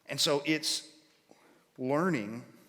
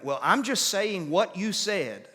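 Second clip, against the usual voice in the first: higher, slower defensive protest; arguing back; 6 semitones higher, 4 dB louder than usual. Acted. Someone making the argument to the other person, arguing back. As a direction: argue your case in a raised pitch. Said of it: "some people will make the argument".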